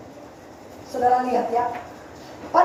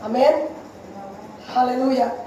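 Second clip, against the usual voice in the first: ordinary talk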